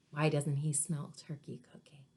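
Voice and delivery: whispered question to the class. Real, not acted. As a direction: hush it like a secret. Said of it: "whispered aside to class".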